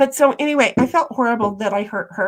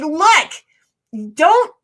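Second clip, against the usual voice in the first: telling a friend not to blame herself, emphatic; emphatic retelling; 4 dB louder than usual. Retold or imitated. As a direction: insist she not take the blame. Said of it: "It's like".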